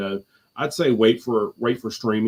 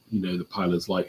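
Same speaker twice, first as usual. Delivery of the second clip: ordinary talk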